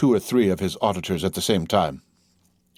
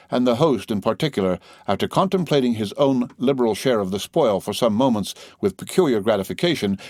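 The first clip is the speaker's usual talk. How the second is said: ordinary delivery, nothing marked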